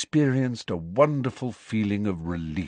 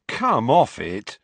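slow, groaning protest; 3 semitones higher, 5 dB louder, slower than usual. A narrator's reading. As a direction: drag it out like a groan. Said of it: "groaned Ron".

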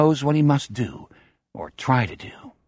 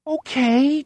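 put-on much higher, whining scared boy; high whiny voice. A narrator's reading. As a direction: whimper it, scared out of your mind. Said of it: "He speaks in a high, whiny voice".

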